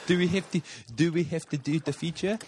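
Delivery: asking through a laugh; words bubbling with real laughter. Real, not acted.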